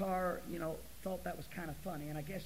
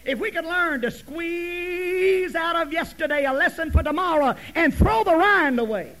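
loud, raised preacher's voice building in force; full-force preaching; 6 semitones higher, 17 dB louder than usual. Real, not acted. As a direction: drive the point home loudly to the congregation.

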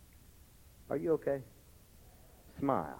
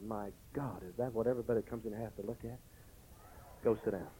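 muttered disgust; 4 semitones lower, 13 dB quieter, faster than usual. Retold or imitated. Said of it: "I said".

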